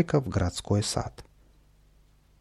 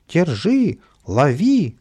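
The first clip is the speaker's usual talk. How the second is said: high, loud pursuit cries; крики толпы; 7 semitones higher, 6 dB louder, much slower than usual. A narrator's reading. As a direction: call out to catch them. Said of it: "кричали старички и няни". (громко, высоко кричит)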